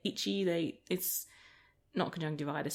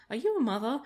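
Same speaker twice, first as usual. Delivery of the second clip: lightly voiced curious child, higher pitch; little girl; 5 semitones higher than usual. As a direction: imitate a child's blunt question, pitched up. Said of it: "the little girl".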